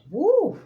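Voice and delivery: high, loud "woo". Retold or imitated. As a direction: whoop it up high. Describing a big stomach.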